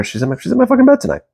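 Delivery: high-pitched, rapid insistence; heated insistence. Retold or imitated. Man insisting to himself she's in his bed, heated. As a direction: imitate stubborn conviction, pitch jumping up fast. Mantra repeated with mounting insistence.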